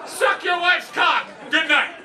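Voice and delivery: loud, high, slow yelled punchline; shouted punchline. Retold or imitated. Comic yelling the anaesthetist's joke as he goes under.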